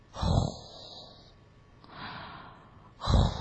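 soft, breathy "hoo" exhale; quiet blown sleeping breaths; gentle snoring. Acted. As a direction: snore lightly like a tiny mouse. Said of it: "轻轻地打着呼噜".

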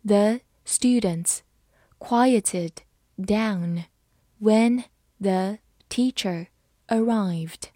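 word by word with clear pauses; slow reading. Shown as a demonstration. Teacher modelling a sentence for learners, slow reading. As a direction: read it slowly, pausing between phrases. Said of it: "好，我们慢读一遍".

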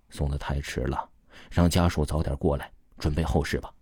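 soft, low-pitched resigned verdict; old professor quietly giving up; sighing resignation. A narrator's reading. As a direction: say it low and subdued, after a sigh. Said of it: "叹息了一声".

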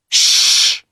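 sharp unvoiced shush; hushing. Boy hushing his friend in the graveyard, sharp shush. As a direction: hush him at once.

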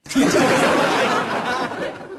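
high laughing burst; bright high laugh; high giggle. Real, not acted. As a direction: giggle at the punchline. Laughs at own joke.

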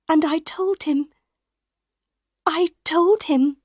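put-on high, pleading young woman's voice; confessing with pity and worry; Christine, troubled. A narrator's reading. Christine confessing to Raoul about the Phantom.

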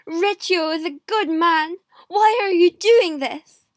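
loud, high outrage; angry protest. A narrator's reading. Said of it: "I asked Darnley angrily".

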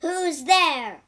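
loud, high monster's challenge; sudden loud monster call. A narrator's reading. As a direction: bark it out as the waking monster. Monster wakes, calls out.

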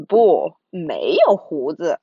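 low monster voice. Acted. Monsters answer in chorus.

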